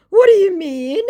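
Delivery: very high, loud rising question; baffled scammer. Acted. Scammer told he's no good at this, mock bafflement.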